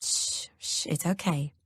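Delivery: soft whispered shush; soothing hush. A narrator's reading. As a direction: hush her gently like a scared child. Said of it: "he soothes".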